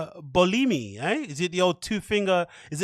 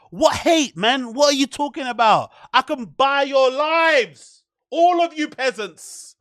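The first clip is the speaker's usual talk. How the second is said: higher, slow, haughty rich-lady voice; pitched-up parody of a billionaire sneering; mock snobby heiress; 5 semitones higher, 3 dB louder, slower than usual. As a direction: look down on the peasants. Voices the rich woman dismissing haters.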